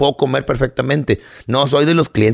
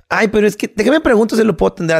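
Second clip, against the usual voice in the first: much higher, fast whiny excuse; hammed-up higher, fast fussy waitress voice; mocking waitress; 8 semitones higher, faster than usual. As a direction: parody the waitress stalling.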